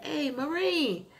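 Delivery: retold call to get his attention. Firefighters call out to Marine.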